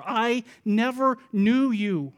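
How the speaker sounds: slow, weighty pronouncement; deliberate, spaced-out rejection; final disowning. Acted. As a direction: stretch each word of the verdict. Bridegroom's final verdict.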